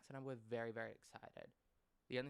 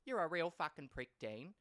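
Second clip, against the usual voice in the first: louder, scornful telling-off; mum telling him off; 5 semitones higher, 6 dB louder than usual. Retold or imitated. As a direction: spit the insult at the husband. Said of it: "I remember it".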